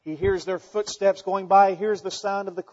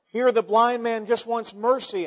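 ordinary talk, nothing marked

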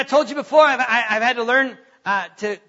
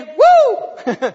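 a loud high cheer; joyful whoop; 5 semitones higher, 7 dB louder than usual. Retold or imitated. Preacher cheering from the pool, a loud joyful whoop. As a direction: whoop it out with glee.

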